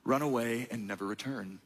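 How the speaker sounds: low and hushed, a hint of a villain; menacing tempter. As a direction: coax him to flee, low and cold. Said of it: "He says".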